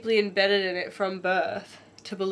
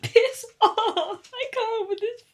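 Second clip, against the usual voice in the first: exasperated outburst; 12 semitones higher, slower than usual. Real, not acted. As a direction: shout at the book in disbelief. Swearing at the sad book.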